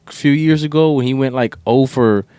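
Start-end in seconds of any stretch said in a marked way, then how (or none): none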